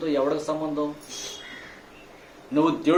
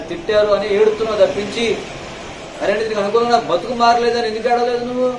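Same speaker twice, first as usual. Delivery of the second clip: preaching at full force; 6 semitones higher than usual. Acted. Pastor rebuking the congregation for false tears, fiery preaching. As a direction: hammer each question at the congregation.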